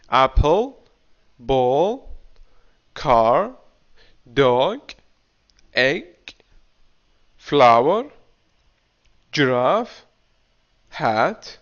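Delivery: each word read out slowly for repeating; teacher reading a word list aloud for the class; slow drill reading. Shown as a demonstration. Teacher to class, slow word drill. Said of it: "repeat after me each word".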